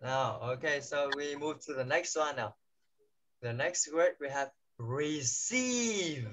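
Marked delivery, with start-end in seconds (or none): 3.4-6.2 receive very slow, stress on the second syllable; slow and clear model word. Shown as a demonstration. Teacher introduces receive slowly, second-syllable stress. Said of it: "Trọng âm sẽ âm, âm thứ hai".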